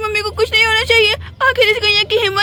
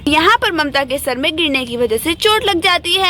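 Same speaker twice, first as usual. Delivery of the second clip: story narration; 4 semitones lower than usual. A narrator's reading.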